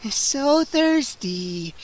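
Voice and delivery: slow stretched vampire murmur; hungry murmur. A narrator's reading. Scene: vampire Gwendolyn licks her lips at Freddy, slow murmur. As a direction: savor the words slowly.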